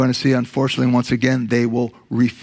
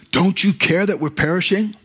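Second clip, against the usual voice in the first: frightened cry; 6 semitones higher than usual. Acted. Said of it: "cry out to Jesus".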